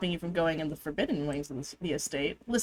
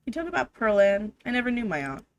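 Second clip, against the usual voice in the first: ordinary talk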